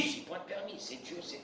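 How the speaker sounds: breathy whisper, resigned; whispered acceptance. Acted. Rabbi voicing the calm believer, resigned whisper. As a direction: whisper it, shrugging it off.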